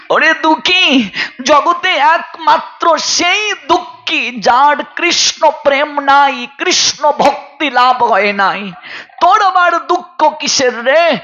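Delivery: high, ringing preacher's voice; acted saint's fervent, high-pitched assurance; impassioned consoling saint. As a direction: call out high to console a devotee. Gaurhari consoles the weeping maid, acted.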